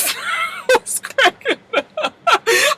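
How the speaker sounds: high, screeching cackle; real howling laugh. Real, not acted. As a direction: lose it laughing. Cracking up at Polly.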